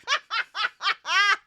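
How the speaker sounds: squealing laugh; helpless high laugh at the joke; high burst of laughter. Real, not acted.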